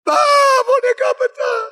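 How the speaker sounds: put-on high, loud child's wail to his father; crying child. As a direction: sob it out like a little boy running to dad. Anak mengadu pada ayah. (anak kecil menangis mengadu pada papa)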